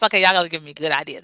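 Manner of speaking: ordinary delivery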